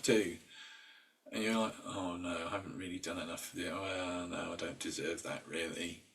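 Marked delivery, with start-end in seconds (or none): 1.8-6.0 softer, small self-doubting voice; mock self-deprecating mutter. Imitating the inner voice that says you don't deserve a treat, quiet self-doubt. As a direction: play down your own worth, quietly.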